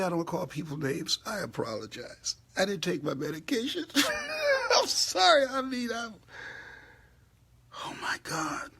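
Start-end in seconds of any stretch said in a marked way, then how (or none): 0.0-3.9 hammed-up fake apology, ending fast in a cry-shout; mock apology. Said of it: "Then I say". 3.9-4.7 shrieking laugh at her own joke; high burst of laughter. Real, not acted. 4.8-6.1 high and loud, still laughing; laughing apology. Real, not acted.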